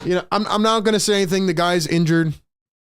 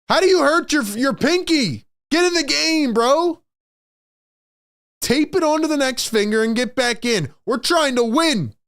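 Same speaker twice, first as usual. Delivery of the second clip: exasperated outburst; 8 semitones higher than usual. Real, not acted. Fan berating injured star to play, high and fed up. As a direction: yell at him to get back in.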